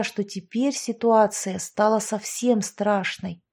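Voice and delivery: usual speaking voice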